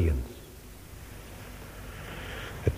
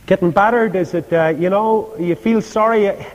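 mocking heckler; 6 semitones higher, faster than usual. Preacher taunts Paul in shipwreck.

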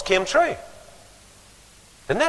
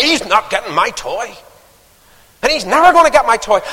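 spoilt brat; 8 semitones higher, 7 dB louder than usual. Retold or imitated. Spoilt boy screaming.

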